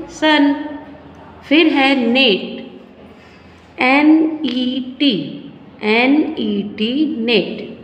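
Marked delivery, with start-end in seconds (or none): 3.8-6.9 slow spelling. Shown as a demonstration. Teacher spells word slowly.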